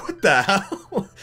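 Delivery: high, slow, laughing exclamation; amused disbelief. Real, not acted. Reviewer baffled by the princess joke, laughing. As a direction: laugh through a baffled reaction.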